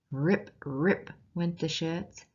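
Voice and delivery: usual speaking voice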